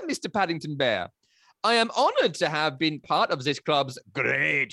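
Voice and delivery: put-on theatrical German accent with a tinge of French; Mister Gruber accent. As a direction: play a grand farewell in a hammed-up German accent, voice jumping up. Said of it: "German French version of Timmy Tickles".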